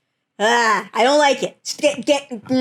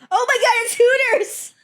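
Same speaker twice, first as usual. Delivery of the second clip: high-pitched, laughing shriek of discovery; laughing realization; 13 semitones higher than usual. Real, not acted. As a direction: burst out as the joke suddenly lands. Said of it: "I just realized it".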